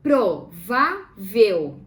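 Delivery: PRO, VÁ, VEL, loud and slow; "Provável" as pro... vá... vel, firmly; syllable by syllable. Shown as a demonstration. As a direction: break "Provável" into three syllables with pauses. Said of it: "Vamos separar em sílabas".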